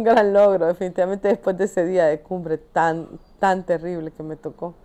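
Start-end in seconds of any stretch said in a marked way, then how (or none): none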